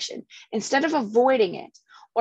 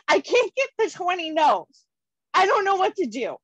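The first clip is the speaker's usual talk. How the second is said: put-on high, complaining young woman's voice, much higher; whiny young colleague; 8 semitones higher than usual. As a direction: play the frustrated young agent whining. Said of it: "She's like".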